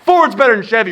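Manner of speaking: high, very loud mock argument; belligerent Southern yell. Southerner starts a fight over trucks, belligerent shouting. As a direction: holler it like a hotheaded Southerner. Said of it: "I'm from the South, where you're just like".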